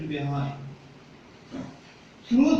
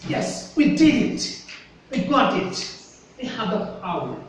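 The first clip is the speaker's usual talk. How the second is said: confident, without doubt; 3 semitones higher than usual. Shown as a demonstration. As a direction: say it strongly, as a proud victory claim. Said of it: "say something without any doubt, strongly".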